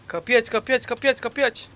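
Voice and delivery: loud, high, insistent repeated call; urgent demand. Real, not acted. Kapının açılmasını ısrarla istiyor. (ısrarla, bağırarak)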